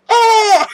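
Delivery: loud, very high hype scream; excited scream. Real, not acted. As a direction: let out a high excited yell. Hyped about a dream mega fight.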